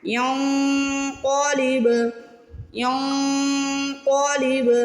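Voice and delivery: ینقلب ending in a bouncing, echoing ب; qalqala demo. Shown as a demonstration. Teacher demonstrating qalqala to students, slow chant. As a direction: end ینقلب with a bounced ب, heavy ق. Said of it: "با کو ہلا کے ادا کرنا ہے".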